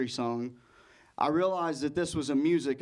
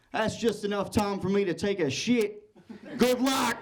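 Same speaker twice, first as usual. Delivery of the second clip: lightly hearty Australian send-off, higher-pitched; cheery Australian host; 5 semitones higher than usual. Acted. Host leaving comic to fill time, cheery Australian accent. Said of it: "he smacked me on the shoulder and he said".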